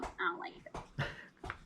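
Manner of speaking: slow unvoiced grumble; whisper. Real, not acted. Complaining to her friend about foamy beer, a slow whisper. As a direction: whisper it slowly, half to herself.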